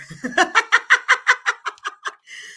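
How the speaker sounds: long, high cackle of laughter. Real, not acted. Host cracking up at Nora's corner joke, long high laugh. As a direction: fall out laughing.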